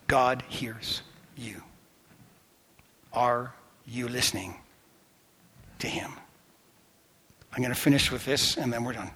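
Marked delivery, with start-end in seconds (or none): 0.1-6.1 whisper. Acted. Preacher whispering to listeners that God hears them. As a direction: lean in close and whisper. Intimate closing appeal.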